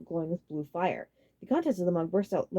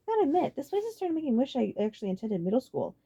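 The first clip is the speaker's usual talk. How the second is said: put-on high, bright girlish giggle in the voice; light girlish voice, nearly laughing; giggly Celia; 8 semitones higher, 3 dB louder than usual. A narrator's reading. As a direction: giggle through it like a smitten girl. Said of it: "she giggled".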